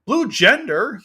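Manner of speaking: high, loud cry of disbelief; outraged scream. Real, not acted. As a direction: shriek it as an indignant question. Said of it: "Sorry, I screamed".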